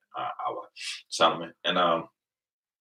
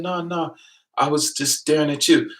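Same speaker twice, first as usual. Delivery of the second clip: imitating the guy; 10 semitones higher than usual. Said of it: "He said".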